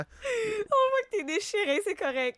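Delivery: mock sympathy. Teasing a torn cohost.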